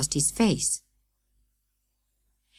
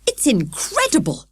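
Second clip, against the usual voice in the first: excited shout; 9 semitones higher than usual. A narrator's reading. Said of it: "shouted Father".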